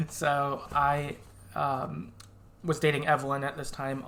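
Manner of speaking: usual speaking voice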